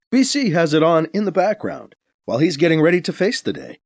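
usual speaking voice

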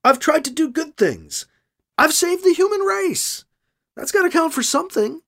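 man defending his record, insisting it counts. A narrator's reading. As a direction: push hard to be believed. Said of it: "he insists".

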